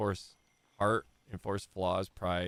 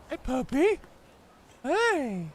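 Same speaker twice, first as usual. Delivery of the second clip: high cooing voice for the puppy; 4 dB louder, slower than usual. Real, not acted. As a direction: coo at the dog, way up high. Greeting the dog in a high voice.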